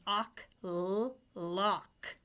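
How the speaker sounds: "ock", then "l-", then "lock" blended; sounding out. Shown as a demonstration. Teacher sounding out a word for kids. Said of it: "Let's sound it out".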